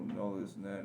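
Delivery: ordinary talk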